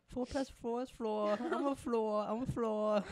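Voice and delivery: high, loud put-on silly voice; imitating Marina. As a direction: mimic the YouTuber's goofy math-joke voice. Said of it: "that's a reference to one of her other videos".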